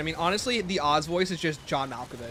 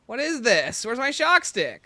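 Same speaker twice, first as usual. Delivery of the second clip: indignant; 9 semitones higher, 4 dB louder, slower than usual. Real, not acted. Upset at losing item.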